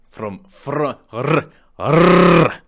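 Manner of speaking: failed rolled r, high and loud; trying to roll R. Shown as a demonstration. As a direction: try hard to trill the r in "r-". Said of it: "I can't roll my R's".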